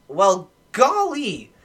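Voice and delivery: mock a bewildered drunk buddy, pitch soaring; Ricky. Friend retells his buddy's funniest sentence, hammed-up drunk voice. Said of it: "I've never heard him talk like this".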